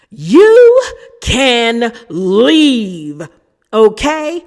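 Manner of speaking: loud, high, drawn-out dismissal; acted yelling. Meredith throwing Angie out of the dinner, acted yelling. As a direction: yell each word as an order out. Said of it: "She was yelling".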